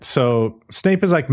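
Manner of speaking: ordinary talk, nothing marked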